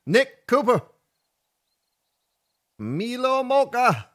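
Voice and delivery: high, loud greeting shout; big, punchy shout-outs over music; announcer roll call. Pretend. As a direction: call out names like a hype announcer.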